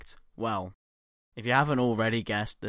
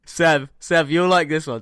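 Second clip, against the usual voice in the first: excited; 6 semitones higher, 9 dB louder than usual. Real, not acted. Eager to share next joke.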